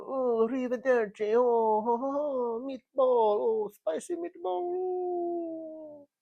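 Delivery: very high, loud cartoon Italian; hammed-up Italian, drawing out the vowels; mock Italian accent. Mocking the host's accent last week, over-the-top high Italian. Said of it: "he was doing the Italian accent last week".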